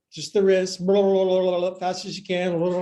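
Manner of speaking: ordinary talk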